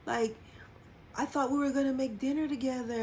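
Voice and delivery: crying plea. Retold or imitated.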